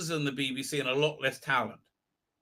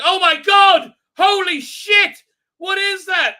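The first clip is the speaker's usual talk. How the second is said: much higher, louder hammed-up shrieks; mock panic; 13 semitones higher, 10 dB louder, slower than usual. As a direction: shriek in fake horror at the monster. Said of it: "And they're like".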